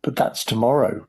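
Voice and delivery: usual speaking voice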